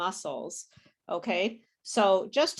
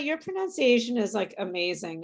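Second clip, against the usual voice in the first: usual speaking voice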